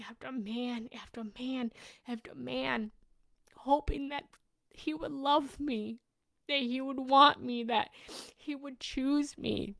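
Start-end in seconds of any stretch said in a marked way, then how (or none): none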